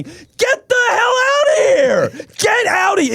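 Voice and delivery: very high, drawn-out shout; incredulous outburst. Real, not acted. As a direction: yell it way up high, stretching the words. Outburst at Hall of Fame argument.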